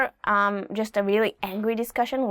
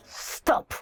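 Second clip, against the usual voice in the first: stern stop; 4 dB quieter than usual. Shown as a demonstration. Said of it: "It's more of like a".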